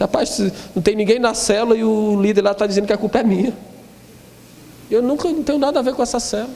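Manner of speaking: high, fast complaining devil; hammed-up devil protesting his innocence; whining devil. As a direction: play the devil whining that he gets the blame. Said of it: "o diabo chorando".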